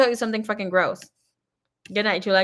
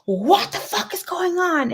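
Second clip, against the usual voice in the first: mock angry dad; 10 semitones higher, slower than usual. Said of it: "this guy came knocking".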